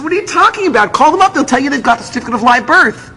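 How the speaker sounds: high-pitched, indignant insistence; exasperated arguing. Retold or imitated. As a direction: push back hard at an official. Father arguing with refusing clerk.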